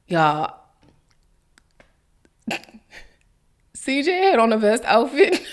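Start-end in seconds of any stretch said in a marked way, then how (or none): 2.5-5.5 laughing through the words; giggling. Real, not acted. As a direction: giggle helplessly. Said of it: "why I'm giggling".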